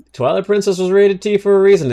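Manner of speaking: high and loud insistence; emphatic. Real, not acted. Streamer telling chat the game earned its rating. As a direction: hammer the point home.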